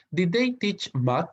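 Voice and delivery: slow, high reading of the question; slow teacher read-aloud. Shown as a demonstration. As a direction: read it out slowly for the class. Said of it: "teacher reading exercise question".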